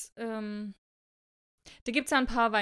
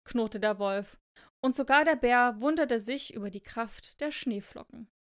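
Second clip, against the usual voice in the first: expressive read-aloud with deliberate phrasing; story narration. A narrator's reading. Reading a snowflake fable to co-host, expressive storytelling.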